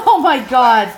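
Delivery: loud, high exasperated cry; real exasperation at the interruption; exasperated outburst. Real, not acted. Husband and kids interrupt filming.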